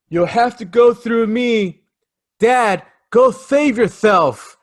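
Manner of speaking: high, loud, over-earnest son's plea; Chip Shreck impression. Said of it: "this is how he says it".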